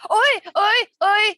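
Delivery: loud, high excited cheering; hyped fan shouting Oy; excited anime fan. Real, not acted. Cheering the anime girl idea.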